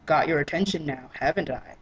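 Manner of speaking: low knowing tease; smug low voice. Acted. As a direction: purr it, pleased with himself.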